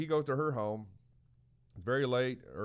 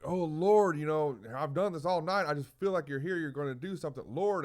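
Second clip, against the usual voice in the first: raised, urgent imitated prayer; crying out; 6 semitones higher than usual. Man praying all night at a deathbed, crying out. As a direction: call up to God, pitched high. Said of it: "But he cried out".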